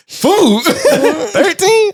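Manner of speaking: high, laughing at the price; laughing disbelief. Retold or imitated. Seeing the food price at the store, laughing high. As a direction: chuckle it out in disbelief.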